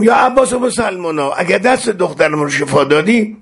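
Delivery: high-pitched impassioned appeal; overcome pleading. Retold or imitated. Mother begging Abbas to heal her daughter, overcome retold plea. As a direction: retell a desperate vow, voice lifted high. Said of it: "یک مرتبه خیلی منقلب شدم".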